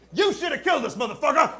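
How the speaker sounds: high, shrill angry outburst; furious lady. Retold or imitated. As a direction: yell it up high like an outraged passenger. Lady angry about survival.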